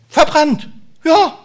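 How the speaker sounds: high, loud cry of Verbrannt; exclaimed. Acted. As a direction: shout the word out to the room. Preacher exclaims.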